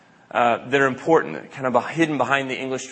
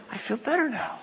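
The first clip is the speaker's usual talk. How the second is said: relieved God after breath; 9 semitones higher, 7 dB quieter than usual. Acted. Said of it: "he's like".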